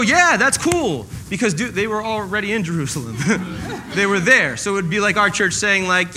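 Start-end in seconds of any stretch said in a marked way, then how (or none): 0.0-1.1 casual agreement. Retold or imitated. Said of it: "And they all go".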